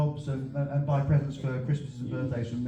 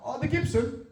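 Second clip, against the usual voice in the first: high flustered reply; flustered realisation; 11 semitones higher, 4 dB quieter than usual. Retold or imitated. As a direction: answer caught off guard.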